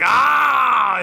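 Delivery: long high, loud "aah"; imitated full-throated yell; mock scream. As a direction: belt out a bodybuilder's posing scream. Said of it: "he's screaming".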